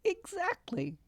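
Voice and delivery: high, delighted fairy voice; gleeful fairy. Acted. Fairy confirms prank to Margaret, high and delighted. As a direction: relish the mischief.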